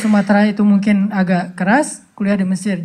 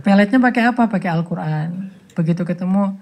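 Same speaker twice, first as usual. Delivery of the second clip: normal talking voice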